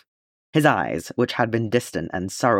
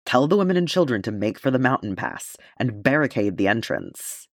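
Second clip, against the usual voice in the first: ordinary delivery, nothing marked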